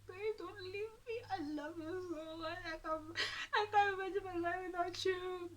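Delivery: very high, whimpering imitation of begging; mock crying. Boyfriend begging her not to leave, mock crying. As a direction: wail it like a pleading, sobbing boyfriend. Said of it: "He was crying".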